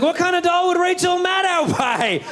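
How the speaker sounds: very high, strained panicky dad voice; high-pitched nervous dad voice; anxious high voice. Retold or imitated. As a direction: squeak out the worry like a frantic parent. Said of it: "I'm just like".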